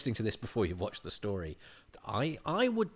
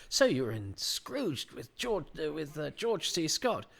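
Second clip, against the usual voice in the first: interviewer's question; 6 semitones higher than usual. Retold or imitated. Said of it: "I'd say things like".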